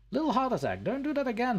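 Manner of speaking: high, loud startled cry. Real, not acted. Scolding the trap after a fright, high startled yelp.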